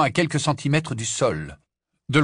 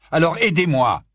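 demand; 6 semitones higher, 7 dB louder than usual. A narrator's reading. Harry demands help.

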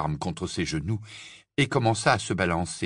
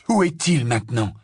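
jubilant; 4 semitones higher, 5 dB louder than usual. Acted. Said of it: "sur un ton de jubilation".

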